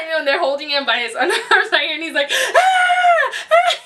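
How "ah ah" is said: high, loud mock newborn cries with a laugh near; imitated baby scream. Mother imitating baby held up by underarms, high mock screams. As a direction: squeal out a newborn's cry. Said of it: "He was screaming".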